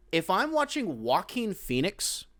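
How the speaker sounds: ordinary delivery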